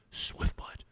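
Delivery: hushed, secretive whisper of the name; retold whisper. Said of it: "Why are we whispering?".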